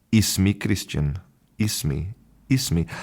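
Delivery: "Ismi" opening on an ee-like i vowel; Moroccan Arabic pronunciation. Shown as a demonstration. Introducing himself as his Moroccan teacher taught, Moroccan "Ismi" with i vowel. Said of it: "my Arabic teacher, she pronounced".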